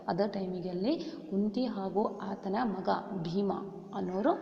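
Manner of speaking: usual speaking voice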